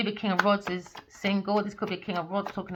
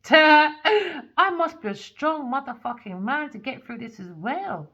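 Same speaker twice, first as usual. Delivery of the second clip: mock brave boast; 5 semitones higher, 7 dB louder than usual. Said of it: "Now he's laughing".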